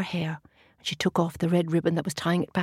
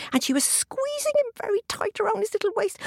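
usual speaking voice

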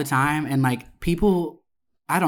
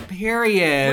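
emphatic, higher sass; sassy period; 6 semitones higher than usual. Retold or imitated. She shuts down the server's flirting. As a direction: end it with attitude. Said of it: "And I was like".

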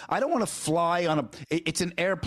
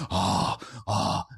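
lower, exasperated huffs; huffing and puffing; 7 semitones lower than usual. Retold or imitated. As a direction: huff like a fed-up passenger. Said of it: "I do the huffing and the puffing".

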